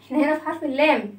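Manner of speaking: ordinary delivery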